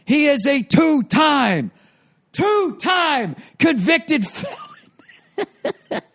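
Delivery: high, very slow announcer shout; ring announcer impression. Imitating the MC introducing the felon, shouted and stretched. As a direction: drag out two-time like a boxing MC. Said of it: "they did that too with the felon".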